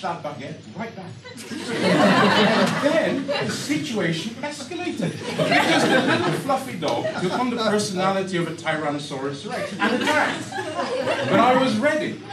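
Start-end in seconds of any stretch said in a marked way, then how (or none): 1.5-12.2 laughing. Real, not acted. Laughing through escalating story.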